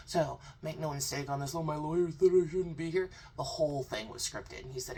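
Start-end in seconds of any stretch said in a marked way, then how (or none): 1.6-3.1 mocking quote. Mocking the guest's excuse.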